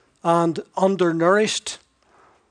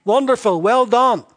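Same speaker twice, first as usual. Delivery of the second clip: higher, louder mock congratulation; sarcastic; 6 semitones higher, 7 dB louder than usual. Preacher voicing James mocking bare belief, sarcastic. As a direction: fake applause that stings. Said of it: "So he says".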